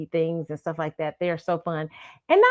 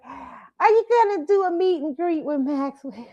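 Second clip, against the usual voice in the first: grandma voice; 9 semitones higher than usual. Retold or imitated. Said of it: "She's like".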